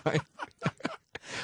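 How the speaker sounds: shrieking high laugh. Real, not acted. Laugh at the wine joke.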